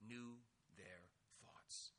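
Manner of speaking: a soft, dramatic hushed reveal; hushed whisper. Real, not acted. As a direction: drop to a whisper on their thoughts. Dramatic hush over Jesus' knowing.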